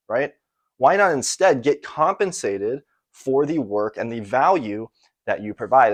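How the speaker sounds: usual speaking voice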